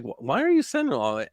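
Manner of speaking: usual speaking voice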